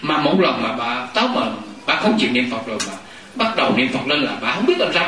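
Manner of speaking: ordinary talk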